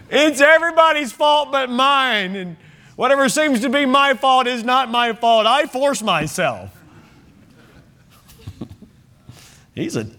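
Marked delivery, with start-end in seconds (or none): 0.1-6.7 high-pitched, insistent protest of innocence; mock self-justifying excuse. Preacher mimicking Saul's excuses to the congregation, mock indignant protest. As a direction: plead blamelessness in a hammed-up high pitch.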